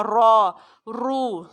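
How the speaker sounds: را and رو said heavy and rounded; heavy Quranic را رو; thick ra and ru. Shown as a demonstration. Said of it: "اس کو موٹا پڑھا جائے گا".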